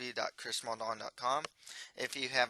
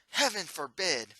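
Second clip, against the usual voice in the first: shocked exclamation; 5 semitones higher, 5 dB louder than usual. Acted. Prince shocked reply.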